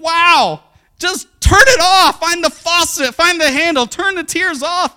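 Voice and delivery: high, loud exasperated outburst; mock exasperated dad. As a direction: play a dad at his wits' end, loudly. Exasperated father to crying son.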